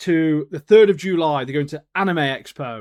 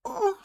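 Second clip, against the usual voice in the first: high-pitched swooning "oh"; sudden high "oh" noise; 5 dB quieter than usual. Real, not acted. Fan raving about sneak peek, high swooning noise. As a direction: let out a squeaky sound of delight. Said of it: "Where did that noise come from".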